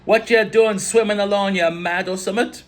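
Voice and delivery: high, loud shout in a put-on Caribbean accent; badly faked island accent at full volume. A narrator's reading. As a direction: yell it as an alarmed islander scolding a swimmer. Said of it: "shouted in my face".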